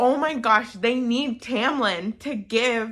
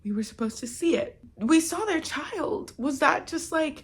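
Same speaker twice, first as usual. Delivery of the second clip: tearful; 5 semitones higher, 3 dB quieter than usual. Real, not acted. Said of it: "crying about this book".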